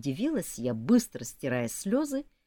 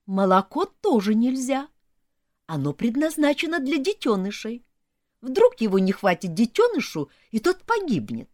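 higher, louder lecturing elf voice; preachy elf; 5 semitones higher, 6 dB louder than usual. Acted. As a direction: sermonize like a prim elf.

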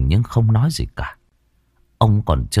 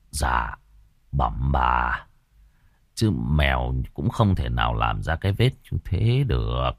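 respectful healer; 7 semitones lower, 4 dB quieter than usual. Acted. Healer answering the lady.

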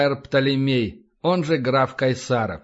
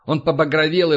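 ordinary delivery

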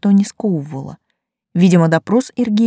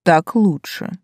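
одобрительно; slower than usual. Acted. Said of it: "одобрил демон".

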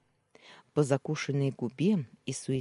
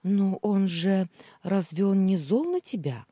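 drawn-out hesitant; 6 semitones higher, 5 dB louder than usual. Acted. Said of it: "протянула Юля".

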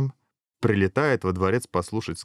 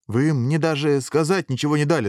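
higher, protesting self-defence; making excuses; 6 semitones higher, 3 dB louder than usual. A narrator's reading. Hero justifying himself to the king, mildly protesting. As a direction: plead your case with mild protest. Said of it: "стал оправдываться герой".

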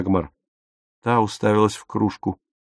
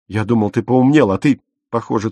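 ordinary delivery, nothing marked

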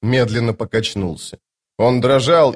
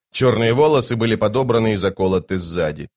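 plain reading voice

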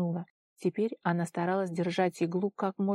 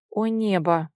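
plain reading voice

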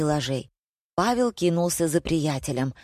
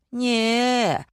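drawn-out, higher-pitched no; mildly theatrical refusal; disagreeing; 7 semitones higher than usual. A narrator's reading. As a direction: stretch the objection upward. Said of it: "не согласилась продавщица".

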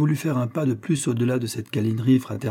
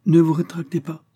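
raised, higher urgent cry; exclaiming; 6 semitones higher, 4 dB louder than usual. Acted. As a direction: call out to stop her speaking. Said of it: "m'écriai-je".

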